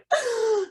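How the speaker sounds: sharp high startled gasp; retold gasp. Mother retelling her fright, sharp high gasp. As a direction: gasp like you just saw a mouse. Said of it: "I went".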